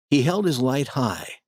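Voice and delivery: usual speaking voice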